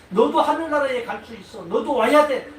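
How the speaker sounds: voicing Jesus. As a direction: call them to heaven with conviction.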